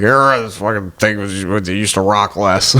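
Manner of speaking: low-pitched imitation of a griping old man; grumbling old man. Passenger imitating old man complaining about the boat, low grumble. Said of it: "He's bitching out loud".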